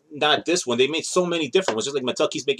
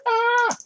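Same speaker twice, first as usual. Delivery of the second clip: startled yelp. Real, not acted. Toy falls out of window.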